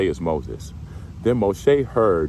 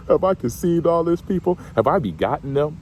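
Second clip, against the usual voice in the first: high, incredulous protest; can you believe this, God; whiny Moses; 10 semitones higher than usual. Retold or imitated. Moses protesting to God that these aren't his kids, high and incredulous. Preacher voices Moses complaining again.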